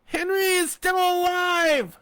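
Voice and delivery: high, loud, slow scream. A narrator's reading. Fanny screaming the truth up at the sheriff. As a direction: scream the warning. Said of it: "she screamed".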